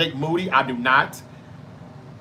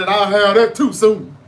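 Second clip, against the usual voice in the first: louder, raised wrestler threat, retold; Mark Henry; 4 semitones higher, 7 dB louder than usual. Mark Henry eyeing the championship belt, loud mock boast. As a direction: claim the title like a promo. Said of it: "he said".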